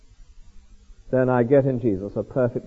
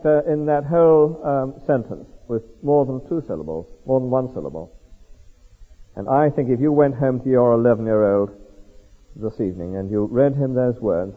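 normal talking voice

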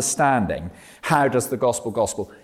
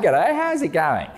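Australian greeting; 4 semitones higher, slower than usual. Retold or imitated. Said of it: "if you're Australian".

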